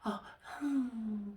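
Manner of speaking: high-pitched "oh" into a hum; high, strange cooing hum; odd little "oh, hmm". Retold or imitated. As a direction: imitate her weird hum. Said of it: "she does this weird thing".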